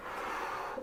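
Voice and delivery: sharp gasp at a near drop; quick frightened intake of breath; startled gasp. Real, not acted. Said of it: "oh my god, I almost dropped it".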